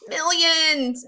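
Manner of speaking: put-on kid-show host announcing a huge number; excited shout. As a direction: belt it out in amazement. Worm answers, amazed.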